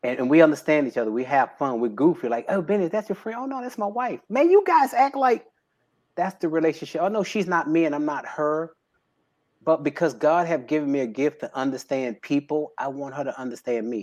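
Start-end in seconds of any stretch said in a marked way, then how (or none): 2.5-8.7 lightly voiced bystanders, high; mock questioners. A touch of amazed strangers, high, asking about his wife.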